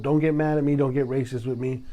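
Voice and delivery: ordinary talk, nothing marked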